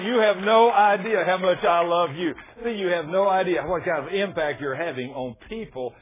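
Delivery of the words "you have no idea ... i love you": loud, impassioned outpouring; emphatic declaration. Retold or imitated. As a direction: proclaim it at full preaching force. Wife's declaration at full force.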